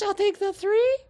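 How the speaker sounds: Fantine's choice. Acted. Fantine's dim-witted choice.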